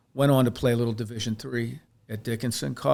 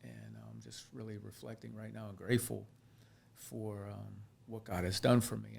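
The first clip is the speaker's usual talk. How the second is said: tearing up; 10 dB quieter than usual. Real, not acted. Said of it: "I feel like I'm tearing up".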